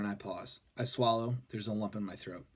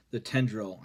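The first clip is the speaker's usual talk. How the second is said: usual speaking voice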